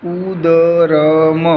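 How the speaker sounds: "उदरम" stretched very slowly, every syllable held; drawn-out pronunciation. Shown as a demonstration. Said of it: "इसको उदरम बोलेंगे". ("उदरम" को खींचकर धीरे बोलें)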